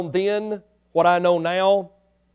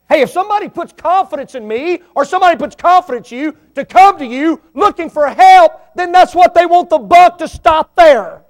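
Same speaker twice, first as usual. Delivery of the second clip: loud, high preacher cry; preaching at full force; 9 semitones higher, 11 dB louder than usual. Real, not acted. Pastor urging the church to keep confidences, loud and high. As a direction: drive it home with force.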